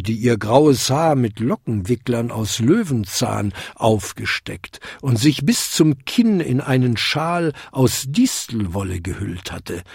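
plain reading voice

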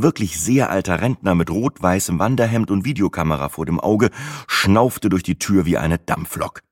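ordinary delivery, nothing marked

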